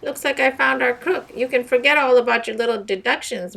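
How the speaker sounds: high, loud gloating; smug triumph. Acted. Peter taunting Meg about her deductions, smug and loud. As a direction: rub Meg's nose in it. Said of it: "said Peter triumphantly".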